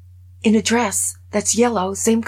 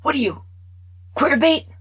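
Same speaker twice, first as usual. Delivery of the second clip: sudden shouted taunt, high and loud; retold scream; 8 semitones higher, 6 dB louder than usual. A boy screams a taunt at his friend, mock yelling. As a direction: yell it at him like a mean kid. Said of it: "Grant screams".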